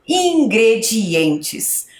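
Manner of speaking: slow, stretched syllable by syllable; drawn-out dictation. Shown as a demonstration. Teacher dictating the heading to pupils, drawn out. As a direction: stretch each syllable for young writers. Spelling out while writing on board.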